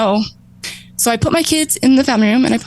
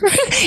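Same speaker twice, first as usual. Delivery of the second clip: high burst of laughter; sudden high laugh at the absurdity; 13 semitones higher than usual. Real, not acted. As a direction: laugh out at the memory. Recalling husband's note, laughing.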